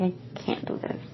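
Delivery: barely voiced, drained whisper; exhausted whisper. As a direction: let it fall to a whisper of defeat. Retelling being too exhausted to drive.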